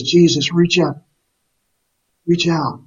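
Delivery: mild retold plea, reaching for help; pleading. Leper calling to Jesus for healing, mild earnest plea. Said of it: "So he's pleading, he's emotional".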